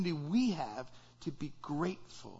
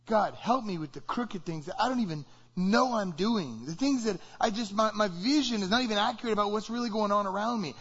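earnest prayer; 4 semitones higher than usual. Acted. Voices a confessional prayer.

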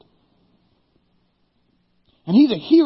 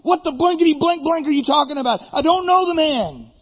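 higher, louder, fast cursing rant; cursing denial; 9 semitones higher, 4 dB louder than usual. Acted. Peter curses at his accusers, fast, higher and louder. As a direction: swear it off in a hot rush. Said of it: "called down curses on himself".

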